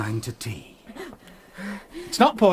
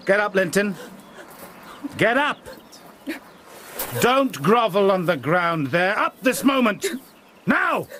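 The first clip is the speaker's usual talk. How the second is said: rising, higher barked orders; angry commands; 5 semitones higher than usual. Acted. Heathcliff to cowering son, angry rising orders. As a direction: drive the boy up off the ground. Heathcliff bullying Linton to rise.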